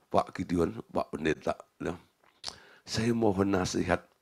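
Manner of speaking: soft and meek, voice dropped low; humble hushed request. Retold or imitated. Director humbly asking the pastor for advice, hushed and meek. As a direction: ask for advice humbly, almost murmuring.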